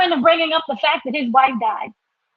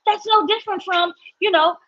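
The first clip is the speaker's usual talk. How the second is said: ordinary talk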